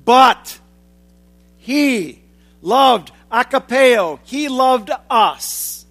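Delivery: But punched loud, then each word set apart slowly; slow, weighty he loved us; emphatic contrast. Shown as a demonstration. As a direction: drive home the contrast word by word. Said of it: "is emphatic and stands in contrast".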